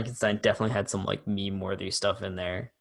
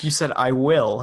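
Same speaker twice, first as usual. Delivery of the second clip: loud accusing quote; 4 semitones higher, 9 dB louder than usual. Retold or imitated. The guy accusing Mina.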